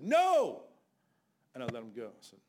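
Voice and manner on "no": sudden loud high shout of the Lord. Retold or imitated. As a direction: bark out the Lord's command. Lord stops him in retold fight.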